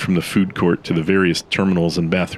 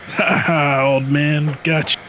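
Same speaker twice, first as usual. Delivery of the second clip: cocky gunslinger taunt; 9 semitones higher, slower than usual. Acted. Nick fake quick-draws at statue.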